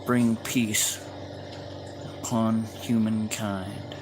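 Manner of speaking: heartfelt spoken prayer. Real, not acted. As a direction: pray over the group gently. Closing prayer for the group.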